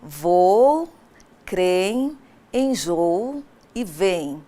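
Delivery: creem, enjoo e vem drawn out slowly and low; slow example list. Shown as a demonstration. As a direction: spell out each example slowly. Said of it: "veja como fica a grafia". (exemplos ditos devagar, bem pausados)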